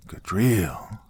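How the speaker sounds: hushed, breathless whisper. Acted. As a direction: whisper the name in awe. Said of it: "Father Donahue whispered".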